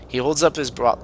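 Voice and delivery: ordinary delivery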